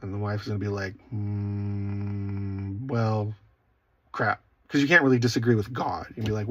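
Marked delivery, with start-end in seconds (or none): 1.1-4.4 low, drawn-out reluctant hum, then giving in; mildly acted reluctant giving-in; grudging concession. Wife conceding to God, grudgingly. Wife hearing God's unwelcome advice.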